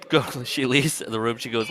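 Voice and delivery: ordinary delivery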